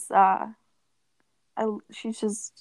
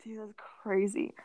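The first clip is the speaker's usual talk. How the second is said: hushed whisper; 8 dB quieter than usual. Real, not acted. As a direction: whisper it under your breath. Whispered aside to friend.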